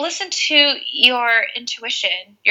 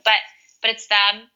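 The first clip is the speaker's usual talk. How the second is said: ordinary delivery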